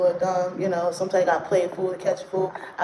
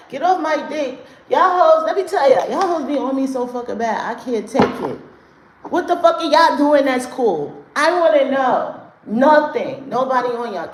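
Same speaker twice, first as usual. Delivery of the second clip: loud, higher, fired-up rant at the chat; angry clapback; 6 semitones higher, 5 dB louder than usual. Real, not acted. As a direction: tell the haters off at full volume. Clapping back at haters in chat.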